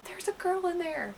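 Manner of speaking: high, soft stage secret; hushed alarmed report. Retold or imitated. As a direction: pass on bad news under your breath. Friend reports what she overheard.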